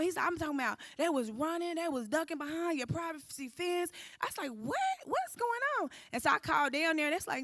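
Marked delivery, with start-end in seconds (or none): 4.5-5.9 surprised disbelief. Retold or imitated. Neighbors tell of police at house.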